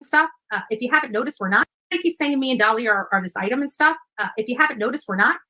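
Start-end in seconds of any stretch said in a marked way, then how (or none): none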